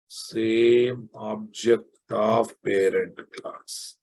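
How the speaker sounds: word by word, slowly; slow dictation. Shown as a demonstration. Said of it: "slow dictation to students".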